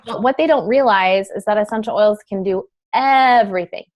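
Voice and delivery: ordinary talk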